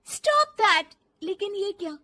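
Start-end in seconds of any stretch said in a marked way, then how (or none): 0.1-0.8 high, loud angry outburst. Acted. Daughter-in-law giving in to mother-in-law's pressure, angry shout. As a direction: burst out with it sharply. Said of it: "जोर से गुस्से से बोल देती".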